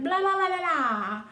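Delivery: high singsong babble; mocking blah-blah. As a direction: drown them out with childish blahs. Scrooge drowning out Jacob.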